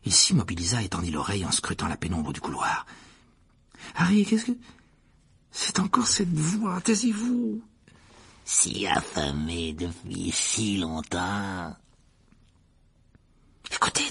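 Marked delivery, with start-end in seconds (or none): 4.0-7.6 put-on high, breath-held boy's voice; urgent hush. Startled boy hushes friends hearing voice. 8.4-11.8 very slow, low hungry menace; murderous creature voice; cold, deadly voice. A narrator's reading. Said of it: "la même voix froide et mortelle". 13.8-14.1 whispered alert; hushed urging. Acted. Boy tells friends to listen.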